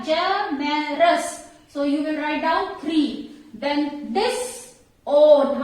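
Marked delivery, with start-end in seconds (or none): 0.0-1.4 Generous slowly split into three beats; syllable by syllable. Shown as a demonstration. Teacher showing students three syllables, Generous broken slowly apart. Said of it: "three syllables are there". 4.1-5.6 disown drawn out very slowly in two beats; syllable by syllable. Shown as a demonstration. Teacher letting students count syllables, disown slowly split. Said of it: "How many syllables?".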